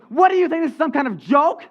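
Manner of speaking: loud, high indignant outburst; acted outrage, voice pushed up; mad. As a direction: berate the prank caller. Angry Marie berates prank caller.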